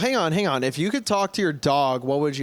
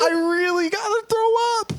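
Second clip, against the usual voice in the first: very high, hammed-up sobbing plea; mock crying. Penis begging its owner to hold on, mock crying. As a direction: wail and beg in a put-on tiny voice. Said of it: "he starts, like, crying".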